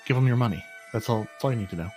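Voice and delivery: ordinary talk, nothing marked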